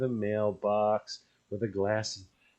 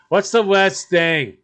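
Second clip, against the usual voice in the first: loud and slow, much higher; teasing; 7 semitones higher, 11 dB louder, slower than usual. Real, not acted. Teasing his partner about dog poop, loud and slow. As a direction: drag out the taunt, loudly and slowly.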